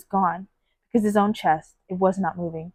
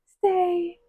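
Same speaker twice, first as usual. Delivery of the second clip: high, pleading stay; high anguished echo of a plea; 9 semitones higher than usual. Retold or imitated. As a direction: voice the haunting repeated stay. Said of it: "it just kept repeating".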